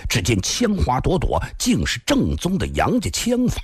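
ordinary delivery, nothing marked

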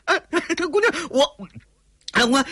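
high-pitched, broken pleading; terrified stammer. Acted. Cornered villain pleads with the girl, high frightened stammer.

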